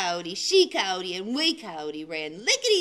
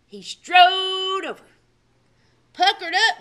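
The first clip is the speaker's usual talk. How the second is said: louder and higher, full of swagger; bold and loud; 4 semitones higher, 5 dB louder than usual. A narrator's reading. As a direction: puff up and boast it. Bold big smooch.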